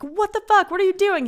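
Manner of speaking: high, loud alarmed outburst; startled yell. Acted. Woman startled by roommate bursting into her room, startled yell. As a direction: shriek at an intruder.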